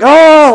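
loud, high wordless holler; re-enacted battle yell. Man shaking a blanket out for yellow jackets, battle yell. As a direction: yell as if going to war.

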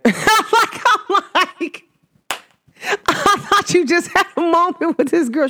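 high, talking through laughter; laughing. Real, not acted. Laughing while retelling the picture story to viewers. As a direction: laugh while getting the words out.

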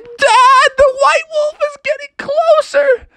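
very high, shrieked warning; terrified Froggy. Acted. Froggy warns Dad wolf is closer, terrified. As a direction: shriek to your father in mock terror. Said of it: "And Froggy was like".

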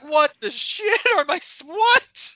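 high, baffled outburst; shocked disbelief. Retold or imitated. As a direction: blurt it out, totally thrown. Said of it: "I was like".

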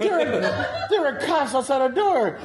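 high, laughing, exasperated protest; comic exasperation, voice shooting up. Real, not acted. Protesting the pizza questions.

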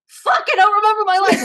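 high, loud blurted panic, half-laughing; mock panic. Actor panicking as the camera rolls, high mock panic. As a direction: blurt it like the camera just rolled. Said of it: "I'm like".

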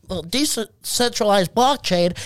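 mock crypto nerd explaining. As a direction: put on a slow nerd voice. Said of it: "there were nerds".

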